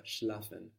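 Schlafen with sch and l run together. Shown as a demonstration. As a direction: glide the sch straight into the l of Schlafen. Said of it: "sch and l there together". (Schlafen, sch und l zusammen)

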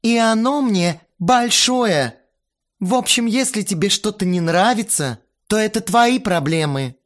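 bossy little princess. A narrator's reading. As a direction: whine like a spoiled diva. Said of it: "капризно сказала Кристина".

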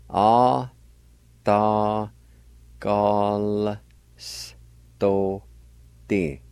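"Ah, Atagalstote" very slowly, each syllable held; syllable-by-syllable pronunciation. Shown as a demonstration. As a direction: stretch and separate every syllable of "Atagalstote". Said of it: "with the G A like this".

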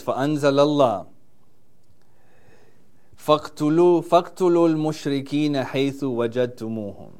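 rapid, chanted Arabic verse recitation; Quranic recitation. Acted. Preacher quoting scripture to the congregation, fast chanted recital. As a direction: reel off the verse in a fast, practised recital cadence.

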